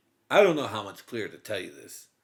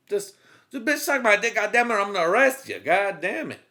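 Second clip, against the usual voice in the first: sleazy cop; 7 semitones higher, 4 dB louder than usual. Retold or imitated. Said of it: "He was like".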